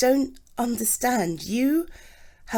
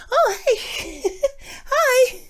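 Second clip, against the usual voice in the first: excited greeting; 9 semitones higher than usual. Real, not acted. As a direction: burst out delighted to see a friend. Greets arriving friend excitedly.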